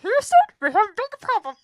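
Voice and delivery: high, loud puppet frog voice; Kermit the Frog voice. Pretend. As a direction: do a goofy Kermit. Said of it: "Why are you Kermit the Frog voice?".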